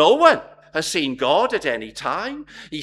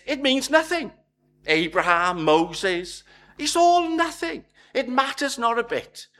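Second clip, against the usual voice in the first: higher, emphatic dismissal; emphatic Jesus; 5 semitones higher than usual. Retold or imitated. Jesus telling the crowd their history means nothing, emphatic and higher. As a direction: voice Jesus sweeping it all aside.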